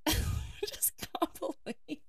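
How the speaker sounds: slow, breathless whisper through a laugh; whispered disbelief. Real, not acted. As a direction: murmur it, almost to yourself. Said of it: "said again plainly in the next line".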